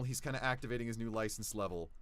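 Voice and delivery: usual speaking voice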